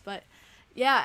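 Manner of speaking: ordinary delivery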